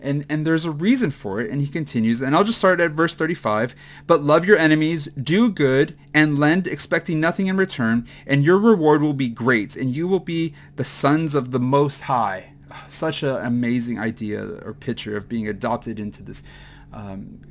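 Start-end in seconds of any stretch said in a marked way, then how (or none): 4.1-12.5 louder, raised scripture reading. Acted. Preacher reading verse to the church, proclaiming.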